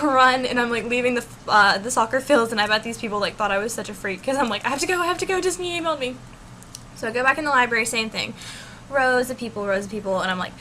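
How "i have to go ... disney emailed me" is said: high, urgent blurting; re-enacted breathless-sounding urgency; frantic excitement. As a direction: gush it out as if dashing off. Retelling shouting to friends while leaving.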